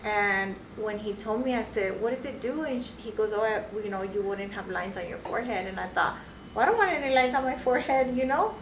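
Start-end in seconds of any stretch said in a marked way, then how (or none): none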